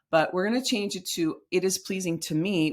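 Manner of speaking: usual speaking voice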